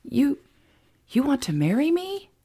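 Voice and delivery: high, halting, astonished question; stunned, voice lifting to a high rise; surprised. A narrator's reading. Emma answering Jason's sudden proposal, surprised. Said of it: "looked at him in surprise".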